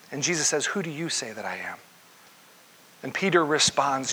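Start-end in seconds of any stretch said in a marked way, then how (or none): 0.6-1.8 quiet pointed question. Retold or imitated. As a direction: lower and softer, asked intimately. Jesus' question repeated, pointed.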